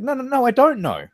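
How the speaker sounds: loud and high, exasperated; mock exasperated. Quoting himself arguing with the guy, loud and exasperated. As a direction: push back hard.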